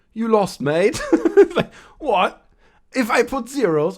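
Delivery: laughing out the words in high disbelief. Real, not acted. Laughing at a commenter's bad math, high disbelief. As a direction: crack up mid-sentence, way up in pitch.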